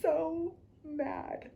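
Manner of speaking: slow, soft, high-pitched whine; mad. Real, not acted. Kicking herself for skipping research, drawn-out high exasperation. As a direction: stretch it out, high and small with exasperation. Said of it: "I'm so mad".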